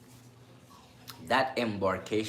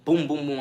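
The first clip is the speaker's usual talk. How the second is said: loud, high booming knocks; imitated door knocking; 7 semitones higher, 9 dB louder than usual. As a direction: mimic hard bangs on a door.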